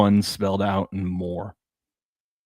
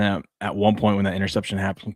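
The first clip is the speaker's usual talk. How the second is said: ordinary talk, nothing marked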